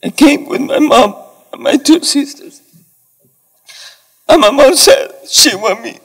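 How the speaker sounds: crying, voice cracking high; fighting back real tears as he speaks; voice breaking. Real, not acted. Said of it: "I'm sorry, I'm a little emotional".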